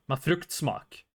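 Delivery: "Med fruktsmak" softly, in a local Norwegian lilt; "Med fruktsmak" yerel Norveç ağzıyla söyle; Norwegian dialect. Shown as a demonstration. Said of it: "in my dialect".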